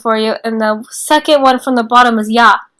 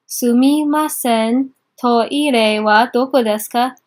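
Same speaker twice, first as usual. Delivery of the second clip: slow model phrase; slower than usual. Shown as a demonstration. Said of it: "teacher repeats phrase slowly".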